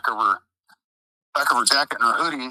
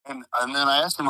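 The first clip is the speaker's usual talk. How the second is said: ordinary talk, nothing marked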